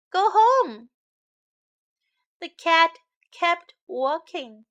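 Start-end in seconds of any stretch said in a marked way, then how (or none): 0.1-0.9 loud, high-pitched order; chasing a stray away with a loud command; firm go home. Acted. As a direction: shout "Go home" sternly, higher and louder. Chorus orders cat home.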